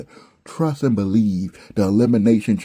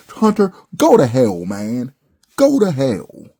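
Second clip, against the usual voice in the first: angry outburst; 4 semitones higher, 5 dB louder than usual. Real, not acted. Ranting at the booker.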